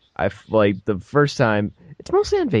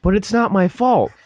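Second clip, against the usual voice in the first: put-on higher, slightly louder childish whine; whiny kid protest; 12 semitones higher, 4 dB louder than usual. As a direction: plead your innocence like a kid. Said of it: "And then I say".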